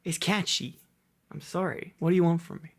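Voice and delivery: ordinary delivery, nothing marked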